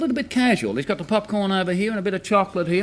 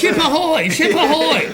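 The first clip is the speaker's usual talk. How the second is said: lookout's call; 6 semitones higher, 3 dB louder than usual. Acted.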